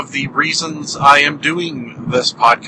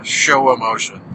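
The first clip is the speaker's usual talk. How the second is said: usual speaking voice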